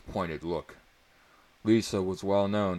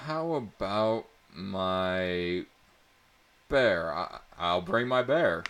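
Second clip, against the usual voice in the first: hesitant offer; 2 semitones higher, 6 dB louder, slower than usual. A narrator's reading. Unsure offer.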